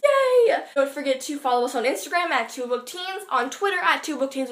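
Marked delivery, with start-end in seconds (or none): 0.0-0.6 high, loud squeal of joy; excited cheer. Real, not acted. Vlogger cheers about the series, loud and high. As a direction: burst out with a bright, loud cheer. Cheering after the book chat.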